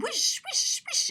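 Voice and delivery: high playful whooshes; breathy high whoosh, whoosh; whoosh sounds. Acted. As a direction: swish it like paddles turning. Paddle sound effect.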